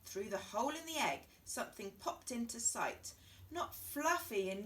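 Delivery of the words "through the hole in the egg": plain reading voice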